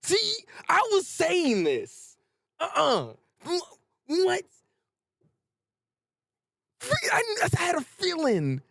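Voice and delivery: high-pitched, stunned outburst; excited vindication. Real, not acted. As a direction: crow in disbelief that you called it. Stunned at seeing theory confirmed.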